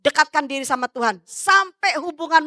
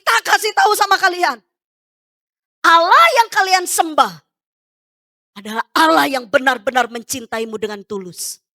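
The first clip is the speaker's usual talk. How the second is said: usual speaking voice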